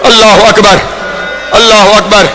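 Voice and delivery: lamenting preacher at full force; impassioned majlis preaching. Acted. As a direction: climb into full grieving oratory. Majlis narration climbs to wailing peak.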